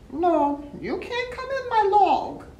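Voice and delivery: high loud grumpy refusal; groundhog voice. Acted. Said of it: "said the groundhog".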